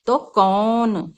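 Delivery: कौन stretched very slowly with a long औ; slow demo word. Shown as a demonstration. Teacher slowly saying कौन for children. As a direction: draw out कौन. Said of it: "तो ये बन गया कौन".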